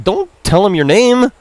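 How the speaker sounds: mock scolding brother. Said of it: "He's like".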